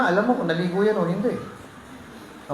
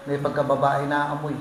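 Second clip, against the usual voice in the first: ordinary talk, nothing marked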